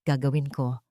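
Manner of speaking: normal talking voice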